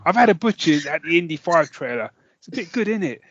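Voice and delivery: a hint of a put-on London accent; lightly mock London geezer; fake London accent. To Disney about the trailer, in a light fake London accent. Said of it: "my fake ac-, London accent".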